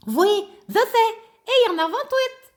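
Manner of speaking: high, bouncy little puppet voice; high childlike puppet greeting the class. Acted. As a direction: play the cheeky puppet pupil. Ratoun puppet talking.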